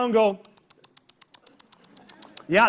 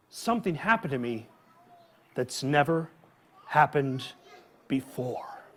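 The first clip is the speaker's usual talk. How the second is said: hushed suspense; 10 semitones lower, 7 dB quieter than usual. Acted. Storyteller teasing a secret to the kids, hushed. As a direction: draw them in quietly.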